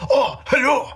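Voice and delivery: exaggerated samurai greeting in pidgin English, high. Podcaster mocking a film's samurai robot, exaggerated pidgin English. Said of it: "who speaks in a pidgin English".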